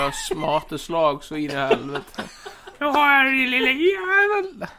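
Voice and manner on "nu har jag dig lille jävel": high, loud laughing shout, stretched at the end; triumphant yell. Acted. Suspect gloats while punching the wrong man, high and loud. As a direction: gloat as you land punches. Beats who he thinks is Elias.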